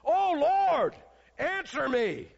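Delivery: a high, loud Oh Lord; loud cry. Retold or imitated. Elijah's cry repeated to show its power. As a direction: cry out answer me. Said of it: "Can you imagine".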